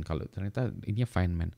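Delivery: ordinary delivery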